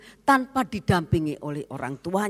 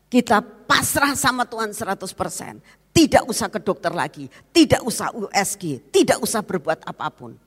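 louder, insistent surrender; louder resolute vow; firm vow; 4 semitones higher, 6 dB louder than usual. Retold or imitated. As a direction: insist on each refusal. Husband's resolute vow quoted.

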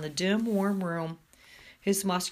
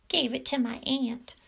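higher-pitched mocking echo; mocking mimicry; 6 semitones higher than usual. A narrator's reading. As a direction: parrot him in a high, taunting voice. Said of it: "mimicked Major".

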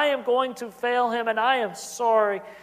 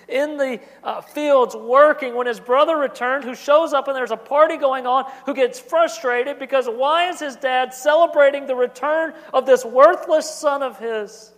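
preaching louder, with mounting force; rising sermon; 5 semitones higher, 8 dB louder than usual. Acted. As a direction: build the sermon toward the older son's grievance. Preacher builds the story.

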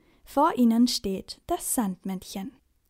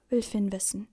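ordinary delivery

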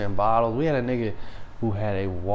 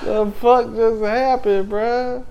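high, laughing disbelief; incredulous; 10 semitones higher, 9 dB louder, slower than usual. Retold or imitated. Retelling his reaction to the light, incredulous. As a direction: draw it out, baffled and cracking up.

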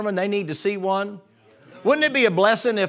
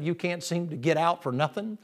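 ordinary delivery

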